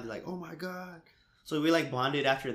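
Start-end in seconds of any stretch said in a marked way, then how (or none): 0.3-1.0 higher-pitched imitation of freaking out; mock freaking out. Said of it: "they were, like, freaking out".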